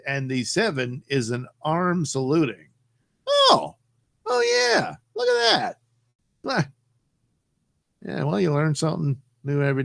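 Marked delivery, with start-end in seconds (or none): 3.3-6.7 sudden delight. Real, not acted. Sees saluting emoticon in chat.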